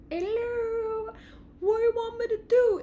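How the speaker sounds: high, eager voice; high ego voice. Acted. Ego greeting intuition, high eager voice. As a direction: chirp it like an eager helper. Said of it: "Your ego is saying".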